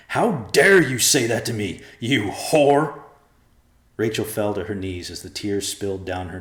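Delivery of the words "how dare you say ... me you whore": loud, high furious accusation. A narrator's reading. Joe turning on his wife with venom, loud. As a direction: spit it at her with venom. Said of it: "turned on his wife with venom".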